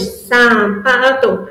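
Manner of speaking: slow syllable-by-syllable sapato; syllables split apart. Shown as a demonstration. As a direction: set out sapato before removing a syllable. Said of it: "A tia tirou esse".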